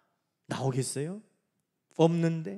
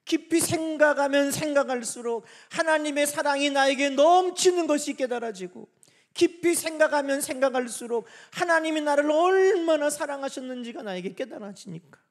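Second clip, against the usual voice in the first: high, loud, swelling sermon voice; impassioned pulpit delivery; fervent preaching; 6 semitones higher, 5 dB louder than usual. Real, not acted. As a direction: build the repetition with fervour, easing at the end. Preaches with rising fervour.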